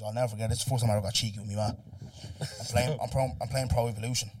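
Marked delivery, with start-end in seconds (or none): none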